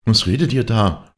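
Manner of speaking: high, firm housewife's demand; scolding mistress. A narrator's reading. Mistress catches the maids gossiping, high sharp question. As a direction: challenge the gossips, pitched high. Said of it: "sprach diese".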